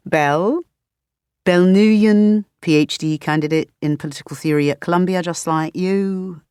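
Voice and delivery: put-on chatty questions in a light British accent; a hint of a puzzled Brit; curious British roommate quizzing; lightly British roommate. Roommate introduces himself, British.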